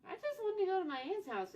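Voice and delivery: high, loud and pleading; emphatic protest. Real, not acted. Insisting on her innocence.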